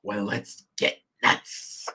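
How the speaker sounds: slow, low whispered menace; a husky vigilante whisper; slow raspy hero whisper; Batman impression. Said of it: "Lamar, you wanted some music".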